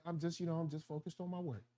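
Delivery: barely audible, muttered brush-off; quiet brush-off. Retold or imitated. Coworker brushing off colleagues, very quietly. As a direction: mumble it away, avoiding them. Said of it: "Be like".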